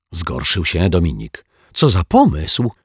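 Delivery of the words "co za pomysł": higher, affronted question; indignant. A narrator's reading. Zuzia is outraged at the detective's suspicion, indignant. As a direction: protest at the very idea. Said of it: "z oburzeniem".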